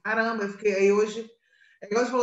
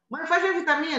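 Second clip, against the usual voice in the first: higher-pitched imitation of a son; son's request; 7 semitones higher, faster than usual. Son to mother just home, lightly imitated request. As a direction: lightly voice a son asking Mom. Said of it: "Ontem mesmo ele falou assim".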